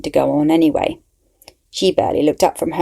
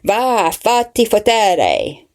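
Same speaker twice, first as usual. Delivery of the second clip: slow, drawn-out Italian swearing, raised high; rude Italian curse; 6 semitones higher, 3 dB louder, much slower than usual. Acted. The doctor curses the investigator in slow, rude Italian. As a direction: spit out the Italian insult, slowly and with relish. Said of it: "replied with an Italian expression so rude".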